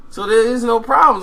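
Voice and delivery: high, loud insistence; exasperated shout. Retold or imitated. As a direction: shout it to end the fuss.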